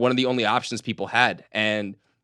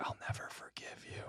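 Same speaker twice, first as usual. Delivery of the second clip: barely audible playful whisper; whispered grudge; 26 dB quieter than usual. Pretend. Co-host to the man who sold Balto, whispered grudge. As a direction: whisper it like a private vow.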